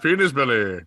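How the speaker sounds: a loose take on Robin Williams, lightly voiced; a hint of Robin Williams; Robin Williams impression. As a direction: toss it off like a quick Robin Williams bit. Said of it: "That's my Robin Williams".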